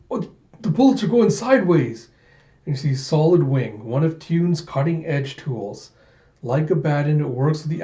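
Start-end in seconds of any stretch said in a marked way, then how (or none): 0.1-2.1 high-pitched disbelief; startled, raised-voice outcry; alarmed soldier. Acted. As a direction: blurt it out in mock alarm. Soldier sees his bullets miss.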